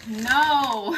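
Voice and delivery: loud sharp no; shouting. Real, not acted. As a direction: shout it out in alarm. Yelling at the bird.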